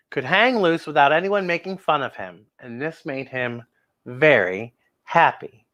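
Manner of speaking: ordinary delivery, nothing marked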